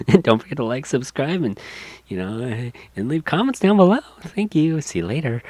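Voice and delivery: breezy upbeat sign-off; bubbly vlogger outro. Retold or imitated. Vlogger urging fans to subscribe, lightly bubbly and high. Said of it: "Lizzie Bubbles ... being like".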